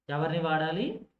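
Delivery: ordinary delivery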